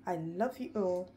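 ordinary talk

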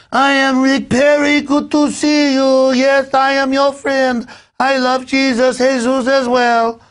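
put-on a wildly overdone Mexican accent, drawn out high; Rick Perry's bad accent. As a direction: parody of a fake Mexican accent. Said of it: "did the worst Southern Californian immigrant, uh, accent".

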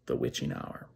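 low, hushed ghost-story whisper; spooky whisper. Acted. Storyteller building dread for the listeners, eerie whisper. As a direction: drop to an eerie breath on the words.

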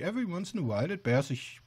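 normal talking voice

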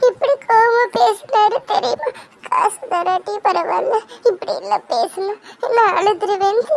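high, tearful complaint with sobs; crying. Acted. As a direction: cry as you tell him. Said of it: "இப்படி அழுதுட்டு இருக்க". (அழுதுகொண்டே முறையிடுதல்)

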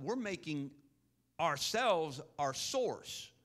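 loud, rising preacher's call, pressing each point; fiery sermon close, voice ringing out; full-force preaching. Acted. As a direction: preach it hard to the church, building. Preaching to the congregation on tithes.

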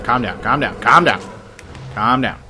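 loud, rising panic, calm down over and over; panicked shouting. Real, not acted. Gamer yelling at the monster to calm down, panicked shout.

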